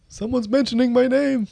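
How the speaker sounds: high put-on rapper voice; [name] impression. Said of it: "a great [name] impression".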